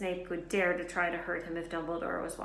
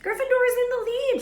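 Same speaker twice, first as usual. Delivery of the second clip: very high, loud victory shriek; 10 dB louder than usual. Acted. Hermione celebrates Gryffindor taking the lead: shrieking. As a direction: shriek the news. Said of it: "shrieked Hermione".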